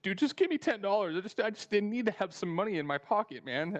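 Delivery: high-pitched imitation of pleading; put-on desperate plea, pitched well up; mock begging. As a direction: beg like a desperate friend on his knees. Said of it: "Gets on his knees".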